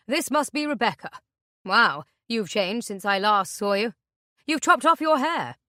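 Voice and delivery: falsely friendly man. Acted. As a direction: play the creepy jovial guest. Intruder's mock-friendly chatter.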